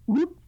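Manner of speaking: quick high "whoop"; sudden rising whoop sound effect; imitated whoop. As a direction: make a little vanishing noise. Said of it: "and he went".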